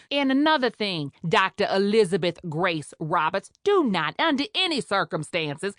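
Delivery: brassy Creole nurse, sharp and scornful; scolding Creole head nurse. Acted. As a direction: put the greenhorn in her place. Nurse continues her tirade.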